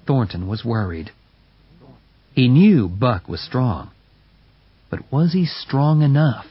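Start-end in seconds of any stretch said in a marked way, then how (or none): none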